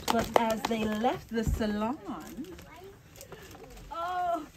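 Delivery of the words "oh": usual speaking voice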